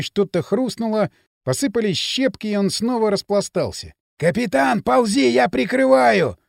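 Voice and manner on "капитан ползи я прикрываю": slow loud covering call; shout. A narrator's reading. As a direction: call out over the gunfire. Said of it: "раздался крик водителя". (крик под огнём)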